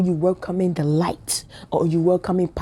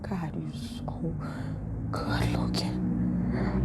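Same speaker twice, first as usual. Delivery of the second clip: breathless whisper to herself; a secret whispered gasp of longing; whispered swoon; 11 dB quieter, much slower than usual. Retold or imitated. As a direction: whisper it like you can't take it. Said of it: "I'm like".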